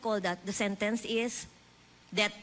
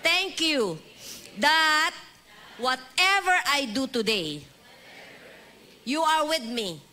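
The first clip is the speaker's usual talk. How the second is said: call-and-response prayer; 4 semitones higher, slower than usual. Shown as a demonstration. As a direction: lead the church in prayer, phrase by phrase. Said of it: "Everybody after me".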